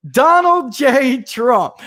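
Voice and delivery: slow, high, loud mock-proud announcement of the name; imitating a wife savoring her answer, drawn out. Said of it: "You should have heard her".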